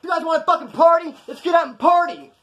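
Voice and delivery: high, loud mock rallying yell; loud outburst. Woken inmate yelling instead of asking for quiet, loud outburst. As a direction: yell it to rouse the whole dorm. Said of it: "Instead of saying, Hey, can you guys be quiet".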